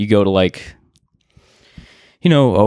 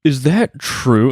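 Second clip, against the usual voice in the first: lightly voiced vampire, drawn-out question; slow, slightly raised mock vampire query; 4 semitones higher, much slower than usual. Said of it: "It was, like, a vampire voice".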